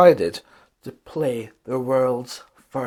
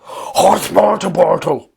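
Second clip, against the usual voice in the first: high, loud nonsense words; gibberish tongue; 4 semitones higher, 8 dB louder than usual. A narrator's reading. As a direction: speak in a strange new language. Gibberish new language.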